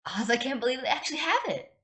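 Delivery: high, gushing food excitement; can barely contain her joy over the stew; bursting with excitement. Acted. Elphaba gushing to friends about ruby stew, giddy and high. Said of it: "looked as though she had nearly burst from excitement".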